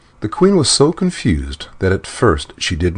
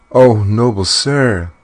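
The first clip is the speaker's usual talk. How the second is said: slow, pleading, in distress; distressed plea; 5 semitones higher, 5 dB louder, slower than usual. Acted. Desperate queen begging the dwarf, distressed plea. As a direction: beg him, voice full of distress. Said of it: "said the queen in great distress".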